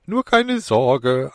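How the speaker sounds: high, slow, put-on honesty; fake sincerity. Demon reassuring Alan with an obviously fake sincere voice. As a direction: overdo sounding trustworthy. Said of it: "stark gekünstelt wirkenden Versuch, ehrlich klingen zu wollen".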